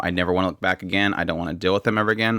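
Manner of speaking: usual speaking voice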